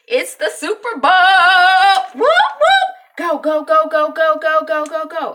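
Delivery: loud, high party whooping and chanting; cheering. Retold or imitated. Dad rallies the house for the Super Bowl, cheering. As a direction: imitate a dad whipping up game-day excitement.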